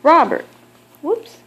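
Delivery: usual speaking voice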